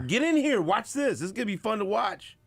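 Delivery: high-pitched excited calling; retold yell. Husband calling his wife in to watch, excited mock yell. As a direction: re-enact summoning someone eagerly to watch. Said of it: "I'm yelling at my wife".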